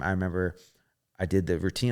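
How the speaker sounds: ordinary talk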